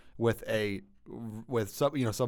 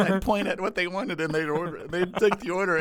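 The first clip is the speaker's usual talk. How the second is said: ordinary talk, nothing marked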